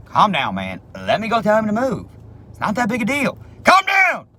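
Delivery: mock-soothe, then shout Calm down. Clerk yells at impatient gas delivery driver to calm down.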